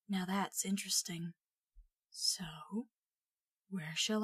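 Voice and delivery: hushed, half-whispered musing; a small voice weighing him up in his ear. A narrator's reading. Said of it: "said a small voice in his ear".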